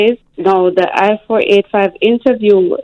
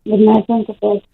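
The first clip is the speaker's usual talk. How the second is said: normal talking voice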